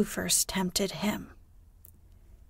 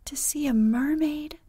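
high, rising, wondering exclamation; long for it aloud, pitch climbing; crying out in longing; 7 semitones higher than usual. A narrator's reading. Wendy dreams of seeing a mermaid, cries longingly. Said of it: "cried Wendy".

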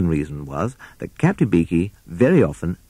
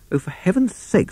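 exasperated; 14 semitones higher than usual. Acted. Owl loses patience with Rat.

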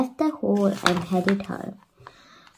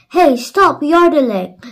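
loud, high shout to stop; 7 semitones higher, 10 dB louder than usual. A narrator's reading. Peter yelling at Jan to stop, loud shout. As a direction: yell over the noise. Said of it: "shouted Peter".

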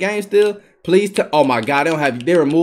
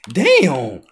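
ordinary talk

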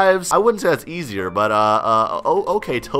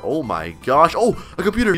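normal talking voice

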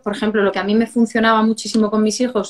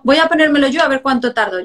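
playful challenge; 4 semitones higher than usual. Retold or imitated. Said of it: "hacerme la tonta".

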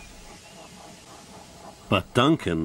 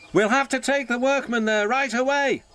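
driver's voice. Acted. Driver calls to workmen.

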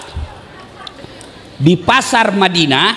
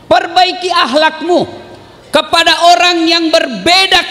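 fiery preaching; 10 semitones higher than usual. Acted. Preacher urging the congregation to honour others' faiths, fervent. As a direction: drive the moral home to the congregation. Preaching moral at full force.

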